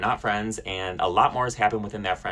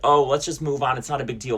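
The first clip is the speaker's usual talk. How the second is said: higher-pitched mocking brush-off; dismissive; 5 semitones higher, 3 dB louder than usual. Mimicking the friend who wants to move on, dismissive. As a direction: imitate them airily waving it away. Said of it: "completely dismissing the whole thing".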